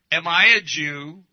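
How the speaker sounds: loud, punched-out indignant question; emphatic retort. Acted. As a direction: throw it back hard, in their face. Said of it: "this is emphatic text".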